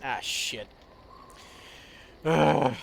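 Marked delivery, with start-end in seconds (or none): none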